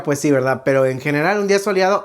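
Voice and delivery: ordinary delivery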